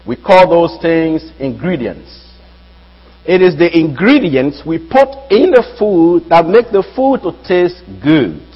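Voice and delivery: ordinary talk, nothing marked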